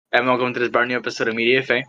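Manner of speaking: rushed fast intro; too fast. Real, not acted. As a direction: rattle off the welcome quickly. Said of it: "That probably was a bit too fast".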